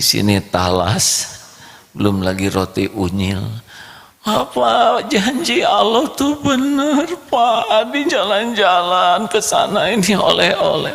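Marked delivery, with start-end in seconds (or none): 4.2-10.9 put-on excited child. Boy excitedly tells father God's promise is true, high child voice. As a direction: blurt it all out to dad.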